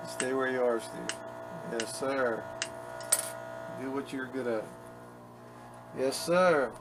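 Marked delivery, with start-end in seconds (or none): none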